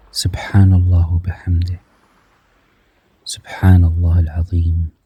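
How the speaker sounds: breathy whisper of the phrase; whispered remembrance of God; whispered dhikr. Real, not acted. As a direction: breathe the words almost silently. Whispered remembrance inside the meditation.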